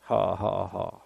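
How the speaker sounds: low, soft ha-ha-ha; deliberate laugh. Shown as a demonstration. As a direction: token chuckle spelled out syllable by syllable. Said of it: "Let's just laugh".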